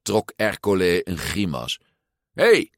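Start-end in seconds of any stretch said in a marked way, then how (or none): 2.3-2.7 high, loud angry shout; bully shouting at children; angry yell. A narrator's reading. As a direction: bark at the kids. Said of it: "schreeuwde Ercole".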